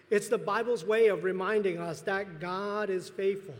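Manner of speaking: ordinary delivery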